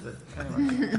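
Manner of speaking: a real laugh breaking through the words. Real, not acted. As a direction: laugh it off lightly, voice lifting high. Laughing off odd memory.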